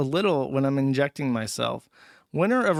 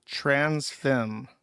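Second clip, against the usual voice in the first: ordinary talk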